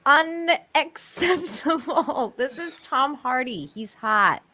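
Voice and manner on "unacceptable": "Unacceptable" stretched very slowly, higher; drawn-out emphasis. Pretend. Co-host repeating her objection, slow and emphatic. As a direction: pull "Unacceptable" apart, syllable by syllable.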